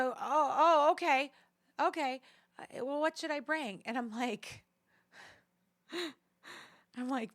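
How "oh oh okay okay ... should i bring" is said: high, loud startled dad voice; imitating flustered dad. Daughter imitating her startled dad hearing of the evacuation, high and flustered. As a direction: fluster like a caught-off-guard dad. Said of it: "He's like".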